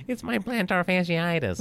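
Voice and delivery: high, whining complaint; whiny voice. Shown as a demonstration. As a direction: whine it like a sulky kid. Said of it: "I always say it with a whiny voice".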